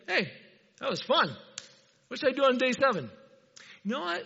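a light playful God voice; cheerful God. Pretend. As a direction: play God pleased with his work. Preacher voices God after creation.

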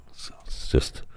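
airy whisper, lost for words; breathless whisper. Real, not acted. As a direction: whisper it in bliss. Savoring bacon smell.

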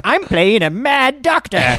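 high, slow hammed-up character voice; mad doctor. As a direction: declare it like a theater kid in character. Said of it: "And I'm like".

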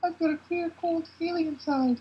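high worried woman voice; high eerie fortune-teller tone; Rita voice. Acted. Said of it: "And Rita's like".

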